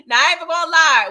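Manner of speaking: loud and fast, all in; fast loud excited confession; excited burst. Real, not acted. Excited outburst in recap.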